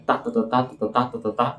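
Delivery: "tá, tá, tá" chanted as a metrical beat; ritmo da métrica. Shown as a demonstration. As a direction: beat out "tá, tá, tá" in a regular rhythm. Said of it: "Você consegue ler com um ritmo". ("tá, tá, tá" marcado em ritmo de verso)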